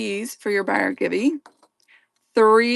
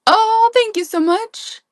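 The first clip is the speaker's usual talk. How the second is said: high, bright gush of thanks; excited thanks; 13 semitones higher, 5 dB louder than usual. Real, not acted. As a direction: squeal your thanks.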